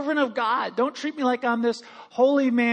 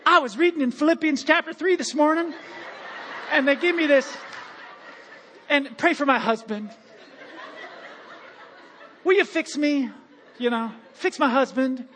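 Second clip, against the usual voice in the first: gushing fan; 6 semitones higher than usual. Retold or imitated. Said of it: "they run up to me".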